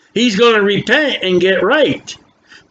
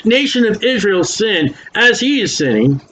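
ordinary delivery

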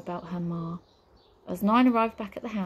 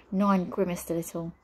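usual speaking voice

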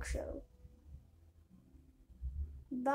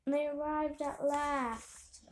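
usual speaking voice

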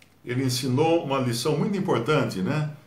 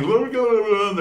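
higher, louder blá blá mimicking ecstatic tongues; mock tongues babble; 8 semitones higher, 4 dB louder, slower than usual. Preacher mocks church tongues with high loud babble. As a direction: ham up the babbling of a worshipper. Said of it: "o outro já fica emocionado".

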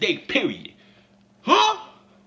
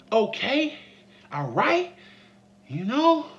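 ordinary talk

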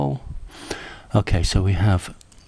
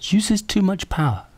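much higher, louder rueful exclamation; exasperated; 10 semitones higher, 5 dB louder than usual. Real, not acted. The audio test crashes it, he exclaims to viewers. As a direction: laugh off the failure.

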